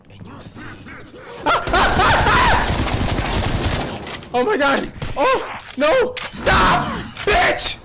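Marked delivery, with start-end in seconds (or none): 0.7-4.3 long high breathless squealing; frightened high-pitched outburst; panicked shriek. Real, not acted. Scared shrieks as zombies attack. 4.3-7.8 high, loud yells of alarm; frantic shrieked protest; panicked screaming. Real, not acted. Attacked by zombies.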